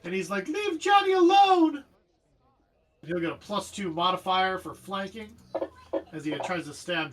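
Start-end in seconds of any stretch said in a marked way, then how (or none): 0.5-1.8 put-on high, slow, loud child's cry; pleading kid voice, drawn out; desperate kid. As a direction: shout up high to protect a friend. GM voices kid defending his friend.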